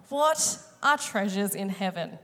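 normal talking voice

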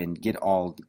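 ordinary talk

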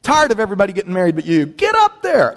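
high teasing mock shove; high mocking guests urging him up; teasing wedding guests. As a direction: needle him like pushy guests.

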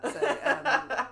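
words through giggles; giggling. Real, not acted. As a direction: giggle while talking. Giggling about dating topic.

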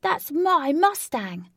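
high, loud, drawn-out angry cry. A narrator's reading. Flash claiming his stolen Mustang, angry shout. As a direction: shout it in outrage, stretching the words. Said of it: "Flash said with anger".

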